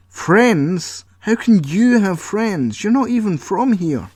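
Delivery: high, loud outraged questions; Paul furious at Colin having friends; indignant disbelief. Acted. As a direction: scoff at the very idea.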